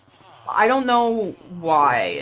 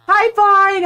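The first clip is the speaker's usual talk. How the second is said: loud, high-pitched hello; excited greeting; 11 semitones higher, 8 dB louder than usual. Real, not acted. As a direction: call out a delighted hello. Calling out greeting to arriving viewer.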